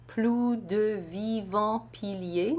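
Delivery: Plus de vivants piliers said slowly; slow recitation. Shown as a demonstration. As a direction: read it out syllable by syllable. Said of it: "let's try the first line".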